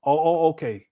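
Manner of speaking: normal talking voice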